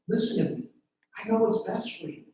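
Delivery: usual speaking voice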